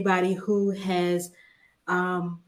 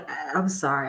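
holding back tears; 5 semitones lower than usual. Real, not acted. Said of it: "I'm trying not to cry".

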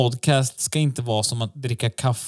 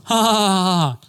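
mock laugh; 6 semitones higher, slower than usual. Mocking the clip's audience laughter.

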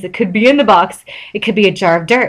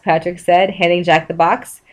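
ordinary delivery, nothing marked

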